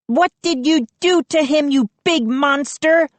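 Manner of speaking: high accusing shriek; Mindy rages at Moose, shriek. A narrator's reading. High furious cry. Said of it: "Mindy shrieked".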